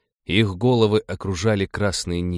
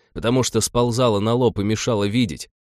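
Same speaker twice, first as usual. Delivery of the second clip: plain reading voice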